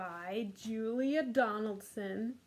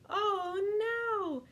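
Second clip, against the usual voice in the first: big high squeal of mock surprise; mock alarm; 11 semitones higher, 8 dB louder than usual. Teacher hamming up the fish being eaten. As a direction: gasp it out like a cartoon fright.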